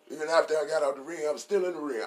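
a touch of a gruff wrestler promo; Stone Cold voice. Retold or imitated. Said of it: "I'm reading this in his voice".

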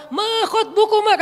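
loud, high mock sermon cry; khotib's preaching tone. [name] wrongly addressing girls like a khotib, mock preaching. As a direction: belt it out like a preacher. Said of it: "Nada khotib".

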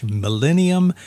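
ordinary delivery

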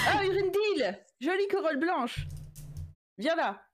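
excited greeting. Acted. Character greets friend joyfully.

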